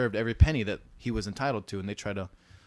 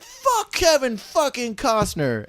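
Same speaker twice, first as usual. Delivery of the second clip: mock furious outburst; 14 semitones higher, 6 dB louder, much slower than usual. Retold defiant outburst.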